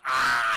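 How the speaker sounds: loud, drawn-out mock scream; hammed-up scream of a movie victim. Blob attacks the projectionist.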